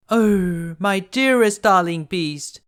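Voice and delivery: loud, high anguished cry to the fallen beast. A narrator's reading. Beauty kneels by the dying beast, crying out in grief. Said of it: "Beauty cried".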